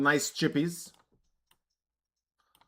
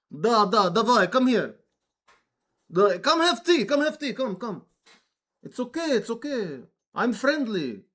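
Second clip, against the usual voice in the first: higher, hammed-up Russian accent, coaxing; Russian dude voice; 7 semitones higher than usual. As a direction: play a friendly Russian luring a guest in. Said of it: "Russian dude voice".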